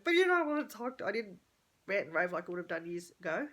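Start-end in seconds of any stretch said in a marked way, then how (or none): 0.0-1.1 high, loud whiny protest; a needy, wounded cry to husband; whiny complaint. Retold or imitated. Wife whining at husband, high and loud. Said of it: "I was like".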